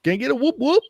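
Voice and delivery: high, excited crowd call; rally the crowd like a hype man. Retold or imitated. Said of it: "He's the one that always goes".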